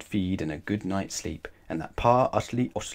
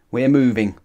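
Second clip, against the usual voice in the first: louder grumpy declaration; 3 semitones higher, 7 dB louder than usual. A narrator's reading. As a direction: grumble it decisively. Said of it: "grumped Pa Utterly Otterly".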